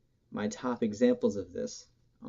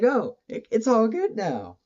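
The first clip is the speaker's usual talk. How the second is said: high, loud "oh" of happy surprise; pleased realization; 12 semitones higher, 7 dB louder than usual. Retold or imitated. Fan recalling his change of mind about a band, brightly surprised. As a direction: voice leaps up in mock revelation.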